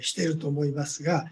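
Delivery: usual speaking voice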